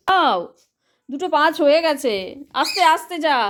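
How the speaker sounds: loud, high call across the house. Real, not acted. Mother calling her little girl from afar, loud.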